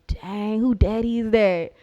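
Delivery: loud, drawn-out heckle; mock calling out. Onlooker spotting someone's dad, mock loud astonishment. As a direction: call it out, stretching the end. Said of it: "I heard somebody go".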